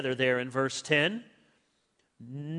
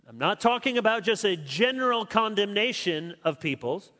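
normal talking voice

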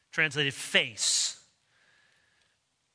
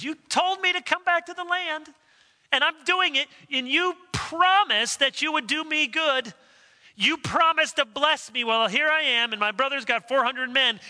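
high, louder pleading prayer; voicing Jacob's prayer; 7 semitones higher, 3 dB louder than usual. Jacob pleading with God, raised and earnest. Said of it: "Jacob says".

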